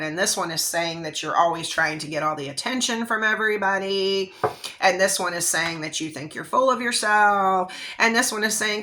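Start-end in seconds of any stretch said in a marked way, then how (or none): none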